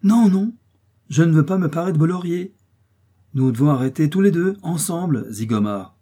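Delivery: high, loud, vehement refusal; sharp lively interruption. A narrator's reading. Detective refuses to take the American's laurels, interrupting vehemently. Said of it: "l'interrompit vivement".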